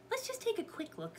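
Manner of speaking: put-on high, light girlish voice; coaxing. A narrator's reading. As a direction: wheedle lightly, pitch way up. Annie coaxes Jack.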